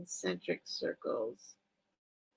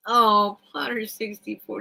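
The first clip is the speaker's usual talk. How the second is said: normal talking voice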